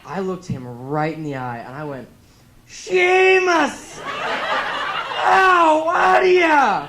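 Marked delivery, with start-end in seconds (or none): 2.7-6.9 huge high-pitched Scottish bellow; copied Scottish accent. Acted. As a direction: holler it like an over-the-top Scotsman, vowels stretched out. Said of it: "I could not help myself".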